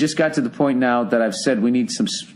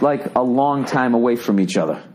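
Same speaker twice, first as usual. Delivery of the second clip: ordinary talk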